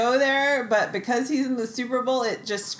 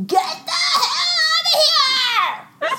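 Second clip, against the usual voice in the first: screaming; 7 dB louder, slower than usual. Pretend. Mom screaming at lactation staff.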